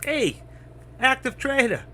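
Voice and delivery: high-pitched imitated greeting; newcomer calling out. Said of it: "somebody walks in and says".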